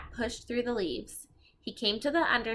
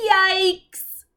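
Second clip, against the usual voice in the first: high, loud startled yelp; dramatic yikes; 9 semitones higher, 11 dB louder than usual. Acted. Teacher reading a chapter title to kids, loud startled yikes. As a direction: read the title as a big fright.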